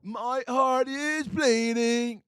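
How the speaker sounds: high, slow, drawn-out emo lament; emo singer wail. Retold or imitated. Friend in his emo days, melodramatic wail. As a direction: wail it like a tortured emo kid. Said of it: "He went from".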